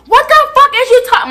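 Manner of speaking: high outraged yell; making a scene. Retold or imitated. Student putting on a scene, high indignant yell. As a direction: yell it like you're causing a scene. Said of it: "I'm putting on a whole motherfucking scene".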